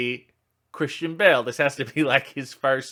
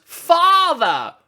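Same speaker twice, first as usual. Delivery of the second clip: put-on high, loud British child-actor cry; 12 semitones higher, 5 dB louder than usual. Boy calls to his father in the dubbed film, British child-actor voice. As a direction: call out in a stagey British child's accent. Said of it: "only what British child actors sound like".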